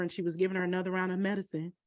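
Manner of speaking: ordinary talk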